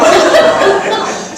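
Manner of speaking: loud high laugh; real laughter; burst of laughter. Real, not acted. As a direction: laugh out loud at your own joke. Laughter continues.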